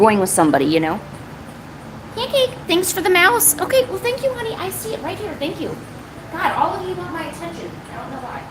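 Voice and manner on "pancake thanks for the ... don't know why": high soft cooing at a cat; pet talk voice. Real, not acted. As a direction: murmur sweetly to the pet. Talking to her cat.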